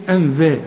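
أنذا with a thin dhal and light a. Shown as a demonstration. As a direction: say أنذا light, tongue forward. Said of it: "وفي ذا". (أنذا بذال مرققة)

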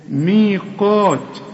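slow chanted recitation; heavy qaf. Shown as a demonstration. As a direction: drag ميقات, qaf at full weight. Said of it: "القاف أعلى درجات التفخيم".

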